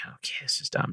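"Okay, this is dumb" breathed soft and voiceless; whispered grumble. Retold or imitated. Hunter sneaking by the bridge, whispering his annoyance. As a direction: mutter it under your breath. Retold thought, said again whispered.

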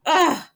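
a loud exasperated groan; frustrated ugh. Real, not acted. Host venting about not being understood, exasperated groan.

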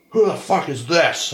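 high-pitched imitation of an outraged cop; mock angry yell. Officer sees the business card, mock angry outburst. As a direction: demand it like an officer blowing up, hammed-up. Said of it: "educating very threateningly".

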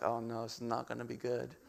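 low, soft, understated dread; low dread aside. Retold or imitated. Father seeing daughter run toward shed, quietly acted dread. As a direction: say it under your voice, pitch dropped.

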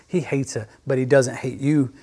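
ordinary talk

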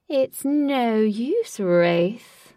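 loud, very slow taunt; echoing villain voice. A narrator's reading. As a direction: draw it out like a call through a hall. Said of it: "echoed Lutz's voice".

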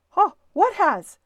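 loud, high-pitched startled reply; startled question. Acted. Husband answering Missus Little's cry, loud and startled. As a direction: blurt it out loudly in surprise.

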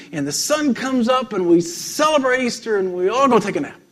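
monk gushing about Easter morning; excited retelling. Retold or imitated. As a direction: rush through it like a thrilled child. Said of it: "he was so excited as he was talking".

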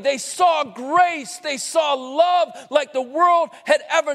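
a preacher building to a peak; building preaching. Acted.

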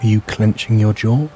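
plain reading voice

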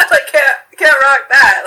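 loud, slowed words broken by laughter; quoting her brother while laughing; laughing through it. Real, not acted. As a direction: get the line out while laughing hard.

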